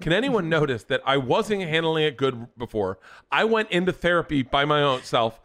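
high, loud mock indignation; indignant plea for credit. Acted demand for credit.

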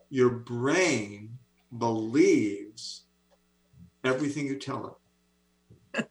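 each phrase spaced out with long pauses; "Your brain ... believes ... everything you tell ... it" laid down word by word; said slowly. Shown as a demonstration. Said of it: "I'll say it slowly".

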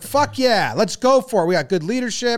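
high, loud pumped-up cheering; hyped soldiers. Retold or imitated. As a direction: pump up like cocky troops. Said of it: "they're like".

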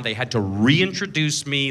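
usual speaking voice

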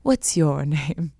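put-on slow, low theatrical accent; imitating a mysterious accented actor; thick-accented wand keeper. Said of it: "with this, like, thick accent".

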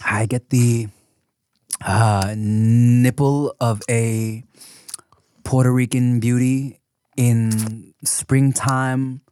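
slow, pompous wine tasting voice; mock wine snob. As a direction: draw out each note like a snob. Parody wine tasting notes.

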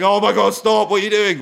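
high, loud mock dismay; mock exasperation. Actor reacting to fans' bad accents, mock exasperated. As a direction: act out a cringing plea to stop. Acting his reaction to fans.

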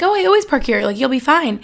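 imitating a carefree friend, high and loud; breezy friend. Friend Taylor insisting she always parks there, high and breezy. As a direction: brush off the worry, high and sunny. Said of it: "Taylor was like".

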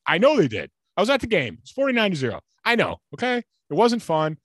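ordinary talk, nothing marked

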